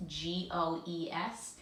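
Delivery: ordinary talk, nothing marked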